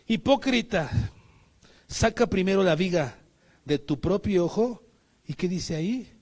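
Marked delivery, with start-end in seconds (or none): 0.1-4.8 stern rebuke. Retold or imitated. Jesus rebuking, quoted verse.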